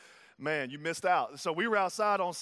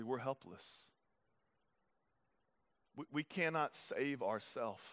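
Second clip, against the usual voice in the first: soft, low, intimate preaching; hushed sermon; 5 semitones lower, 8 dB quieter than usual. Acted. Pastor confiding to the congregation, hushed and tender. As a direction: lean in and speak softly to the congregation.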